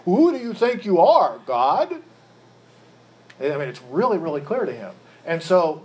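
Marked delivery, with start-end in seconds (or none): 0.1-2.0 raised, louder, incredulous retort; indignant challenge. Retold or imitated. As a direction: throw it back at him, affronted. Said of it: "he would have interrupted Jesus".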